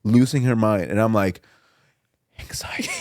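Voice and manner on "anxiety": very soft, voiceless whisper; whispered nerves. Retold or imitated. Man on one knee awaiting her answer, nervous whisper. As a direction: whisper it under your breath. Acts out his nerves while proposing.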